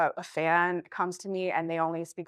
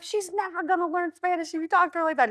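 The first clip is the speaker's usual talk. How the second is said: higher pitched imitation of a friend scolding; imitating Julio; 9 semitones higher than usual. Imitating Julio scolding the neighborhood boys, pitched higher. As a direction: do Julio defending her, pitched up. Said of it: "And Julio was like".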